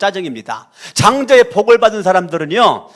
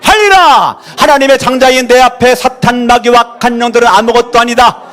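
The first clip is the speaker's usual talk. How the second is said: commanding loud prayer; 6 semitones higher, 7 dB louder than usual. Real, not acted. Pastor loudly rebuking evil spirits before the church. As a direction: drive out the spirits with full force.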